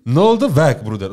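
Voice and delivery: scared yell. Real, not acted. Spider coming close.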